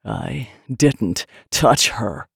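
slow, low, each word separated. A narrator's reading. Man denies it to his lover, word by word. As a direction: force out one word at a time. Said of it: "with every word".

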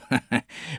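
short chuckle of a jovial landlord; hearty laugh. Acted. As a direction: laugh it off warmly. Landlord laughs mid-speech.

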